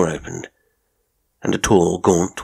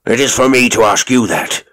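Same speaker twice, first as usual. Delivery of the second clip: loud, raised, enraged retort; furious shriek; 5 semitones higher, 6 dB louder than usual. A narrator's reading. Fat father turning on the intruders, furious shriek. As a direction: throw the challenge back in fury. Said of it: "he shrieked".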